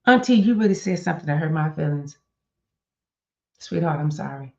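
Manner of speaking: tender real apology; sincere apology. Shown as a demonstration. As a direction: apologize simply, meaning it. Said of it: "An apology is".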